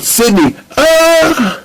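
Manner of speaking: high cry as he drops; falling scream. Acted. Ratchet screams at Sydney as he falls out of the trap. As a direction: scream as the ground rushes up. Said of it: "fell to the ground below".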